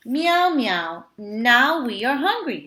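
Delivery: loud high mewing cat voices; hungry cats. A narrator's reading. Hungry cats pleading with the old man, loud and high.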